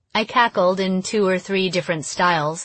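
ordinary delivery

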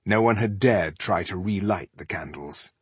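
ordinary delivery, nothing marked

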